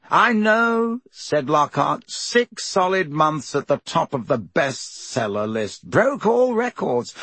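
high, slow, self-delighted bragging; boastful Lockhart. Acted. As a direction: preen about the bestseller list.

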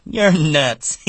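high, loud amused outburst; laughing at him. A narrator's reading. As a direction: tease with a little laugh. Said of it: "Curly's wife laughed at him".

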